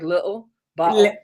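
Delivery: "little, bottle" without a crisp T, the Irish way; Irish T sound. Shown as a demonstration. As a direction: soften away the T in "little, bottle". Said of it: "we don't pronounce our T's".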